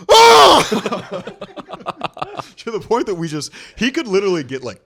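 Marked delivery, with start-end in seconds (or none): 0.1-1.2 loud high yelp; dad's yelp. Retold or imitated. Son imitating his dad's pain yell, loud high yelp. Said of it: "and he'll just go".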